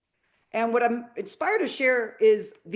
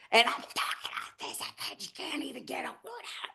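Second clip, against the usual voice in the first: voiceless hoarse whisper, straining for words; laryngitis voice; 3 semitones higher, 10 dB quieter than usual. Retold or imitated. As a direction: imitate a voice lost to laryngitis. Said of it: "that I got laryngitis".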